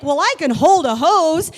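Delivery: put-on loud, high defiant old man; stubborn grandpa. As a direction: insist on it, defiant. Grandpa insisting loudly.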